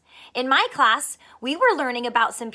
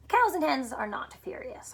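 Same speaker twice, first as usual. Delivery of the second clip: usual speaking voice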